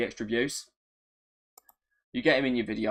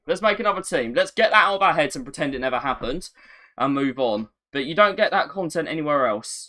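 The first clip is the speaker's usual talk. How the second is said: ordinary delivery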